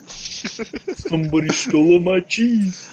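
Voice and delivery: ordinary talk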